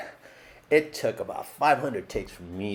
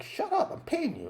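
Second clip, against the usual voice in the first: much higher, laughing bossy retort; mock bossy director. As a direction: ham up the director's snap through a laugh. Quotes director snapping back.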